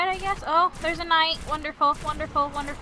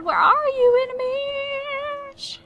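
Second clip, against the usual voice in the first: high, drawn-out playful call; 13 semitones higher, much slower than usual. Pretend. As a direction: lure them out, stretching the last word. Singsong calling out to enemies.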